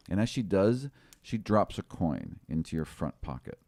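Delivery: normal talking voice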